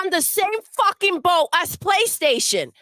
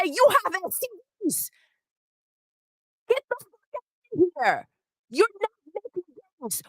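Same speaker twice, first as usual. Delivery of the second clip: quick, softer mock impression of Demo; imitating Demo; 4 dB quieter, faster than usual. Quoting Demo mocking Sony's new box, fast and hushed. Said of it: "like Demo would say".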